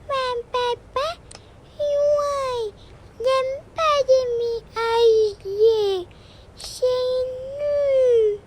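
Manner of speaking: put-on high, slow tearful little girl; a child sulking about the move; little girl telling her parents she hates moving, high and slow with tears; whiny crying child.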